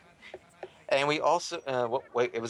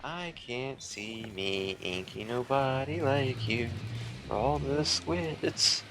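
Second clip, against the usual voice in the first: slow put-on voice; much slower than usual. Slow put-on character line.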